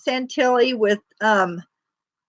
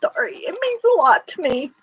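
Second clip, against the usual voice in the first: higher, choked-up apology; getting emotional; 6 semitones higher than usual. Real, not acted. Vlogger thanking viewers, choked up and near tears. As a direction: voice close to tears, still pushing through. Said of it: "I'm getting emotional".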